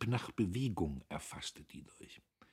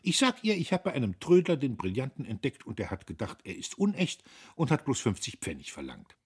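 verschmitzt; 6 semitones higher, 5 dB louder, faster than usual. A narrator's reading. Guste plotting excuse for mother.

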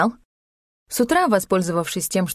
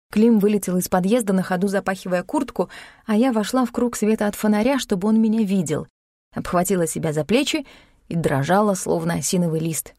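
usual speaking voice

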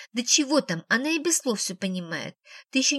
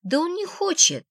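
put-on high, louder childish whine; whining girl; 8 semitones higher, 4 dB louder than usual. A narrator's reading. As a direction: nag like a stubborn little girl. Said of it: "конючила Анфиска". (ноющий детский голос)

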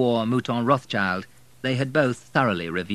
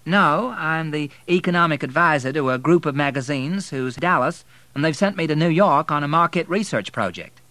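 lightly raised, self-assured woman's voice; a poised Southern lady, lightly voiced; Melanie, brisk and poised; 9 semitones higher, 7 dB louder than usual. A narrator's reading. Melanie explains her job.

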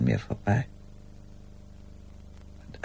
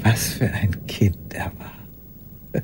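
very slow, lower, whispered fondness; slow tender whisper; 6 semitones lower, 5 dB quieter, much slower than usual. Acted. Old woman remembering him fondly: slow tender whisper. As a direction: linger over it as if stroking a memory.

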